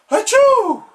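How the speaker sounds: big high sneeze. Acted. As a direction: sneeze loudly mid-word. Sneeze in the middle.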